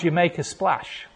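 ordinary talk

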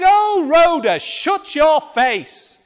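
high, loud, slow put-on sneering voice; hammed-up scornful prayer leader; dismissive sneer. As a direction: drag it out mockingly. Leaders mocking Rhoda.

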